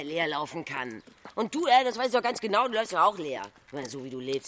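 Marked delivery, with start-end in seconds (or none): 1.3-3.4 loud angry reproach; heated outburst. Acted. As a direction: rail at him, voice raised.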